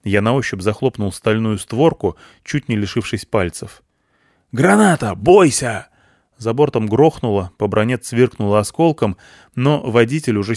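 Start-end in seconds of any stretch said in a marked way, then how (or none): 4.5-5.9 grenade warning shout. A narrator's reading.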